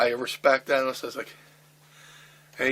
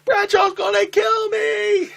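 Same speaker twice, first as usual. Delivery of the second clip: high, loud, slow panicked pleading; scared wrestler protesting to a laughing friend; mock panic; 10 dB louder, slower than usual. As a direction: wail it like you're doomed. Panicking over Bradshaw fight.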